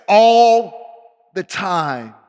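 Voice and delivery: "all" loud and long drawn out; emphatic insistence. Acted. God insisting to the doubting preacher, "all" hugely drawn out. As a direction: stretch "all" right out, loudly.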